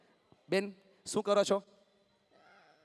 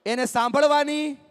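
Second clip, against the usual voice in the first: loud, raised-pitch phrases with long pauses between; loud rallying call; 4 semitones higher, 7 dB louder than usual. Real, not acted. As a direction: call it out phrase by phrase for the crowd to repeat. Rallying audience to repeat after him.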